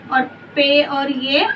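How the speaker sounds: slow, letter by letter; slow sounding out. Shown as a demonstration. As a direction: spell the letters out slowly. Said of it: "तो इसको हम किस तरह जोड़ेंगे?". (धीरे धीरे अक्षर गिनाते हुए)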